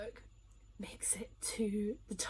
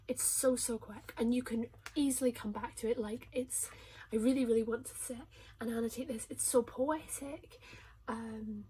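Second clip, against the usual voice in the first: usual speaking voice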